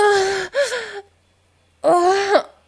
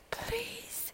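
whispering; 4 semitones higher, 17 dB quieter than usual. Real, not acted. Whispered plea.

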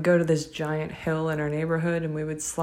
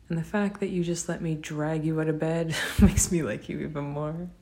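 usual speaking voice

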